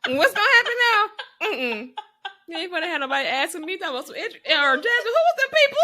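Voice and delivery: high, loud laughing mock quote; imitating Jasmine. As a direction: rattle it off fast, half laughing. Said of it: "she said".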